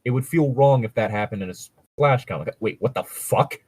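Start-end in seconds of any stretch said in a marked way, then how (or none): none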